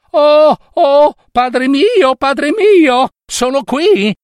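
put-on high, loud girlish cry of joy; high nymph voice. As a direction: call out high and bright to father. Nymph calls to her father.

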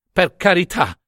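high-pitched appeal; pleading. A narrator's reading. Nunziata begs the curate as her goat bleats, pleading. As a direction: implore him for mercy.

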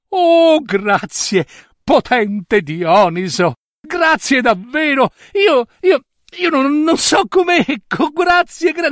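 high, breathless gratitude; a greedy king tripping over his thanks; flustered excited thanks; overjoyed king stammering thanks. Acted.

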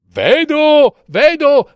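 high-pitched, excited calling; excited sister. A narrator's reading. Sister spots something from the tower, excited. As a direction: call out what you spot, high. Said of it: "rispondeva la sorella Anna".

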